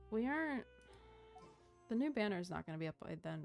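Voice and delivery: ordinary talk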